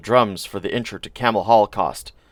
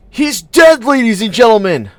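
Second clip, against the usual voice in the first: high, loud cry into the mic; frantic announcement; 11 semitones higher, 7 dB louder than usual. A narrator's reading. Munch yelling into the mic to the crowd, loud and high. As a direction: shout the news to a drunk crowd.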